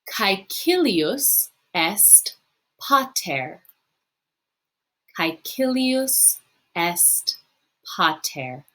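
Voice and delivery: slow, syllable by syllable, for the class to repeat; slow model reading. Shown as a demonstration. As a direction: read it out slowly so students can copy. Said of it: "pause it after I say the sentence, practice saying it yourself".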